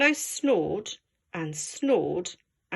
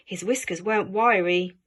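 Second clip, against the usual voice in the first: ordinary delivery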